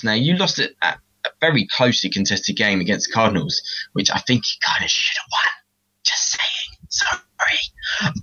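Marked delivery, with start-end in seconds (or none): 3.9-6.8 cheeky whispered aside. Real, not acted. 6.9-8.1 whispered sorry. Real, not acted. Whispered apology after aside.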